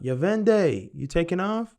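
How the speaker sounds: loud, high call across the room; shouted greeting across a party. Retold or imitated. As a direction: yell the name to catch him leaving. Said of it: "yells out".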